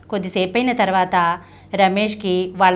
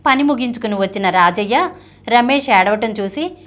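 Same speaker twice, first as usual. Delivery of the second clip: usual speaking voice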